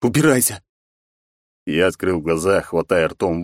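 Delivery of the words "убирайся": shout. Acted. Boy shouts at spirit in dream.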